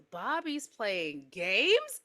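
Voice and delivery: slow, patronizing echo as a question; drawn-out condescending question; talking to a toddler. Acted. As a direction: repeat it slowly, as if to a two-year-old. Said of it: "like she's two years old".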